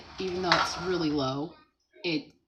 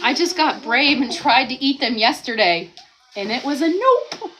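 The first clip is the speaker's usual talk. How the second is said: reading Lynn's comment; 4 semitones higher, 9 dB louder than usual. Retold or imitated. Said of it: "says Lynn".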